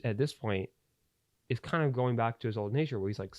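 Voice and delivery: ordinary talk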